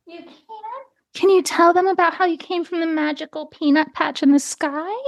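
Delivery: high, sing-song pet talk; cooing to the cat. Real, not acted. Coaxing her cat to greet listeners.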